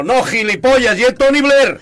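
high, loud exasperated scolding; indignant. Retold or imitated. Aznar scolding Rajoy over the riddle, indignant shout. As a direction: snap at the fool, outraged. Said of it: "dice Aznar indignado".

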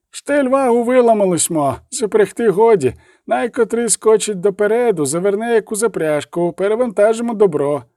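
wagoner's high, put-on voice; shouting back. A narrator's reading. Wagoner explaining the broken wagon to Galada, shouting back. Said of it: "крикнув у відповідь візник".

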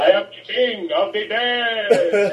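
slow, lightly spooky undead king; a hint of a grand zombie lord, drawn out; Baron Zombie. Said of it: "[name] is Baron Zombie".

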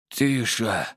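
weak, squeezed-out word; hoarse. A narrator's reading. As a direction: force it from a parched throat. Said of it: "хриплым". (хрипло, с трудом)

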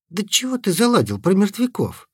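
high shrieking squeal; girlish squeal. A narrator's reading. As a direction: squeal it in a panic. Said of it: "по-девчоночьи взвизгнул".

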